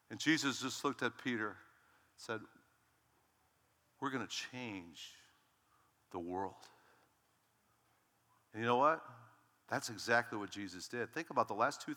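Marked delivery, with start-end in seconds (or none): 4.0-6.6 slow, hushed, lower-pitched declaration; quiet, deliberate gravity; solemn pronouncement. Acted. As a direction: let each word land slowly and softly, with weighty pauses. Jesus' solemn answer to Peter.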